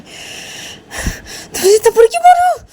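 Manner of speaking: very high, slow wailing question; crying after a slap. Acted. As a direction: sob it out like a slapped child. Slapped girl asks why.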